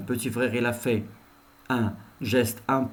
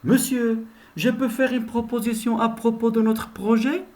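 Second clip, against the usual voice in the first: higher, polite question to the teacher; student proposing an idea to the teacher; 11 semitones higher than usual. Shown as a demonstration. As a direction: ask the teacher permission nicely. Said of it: "voilà un exemple".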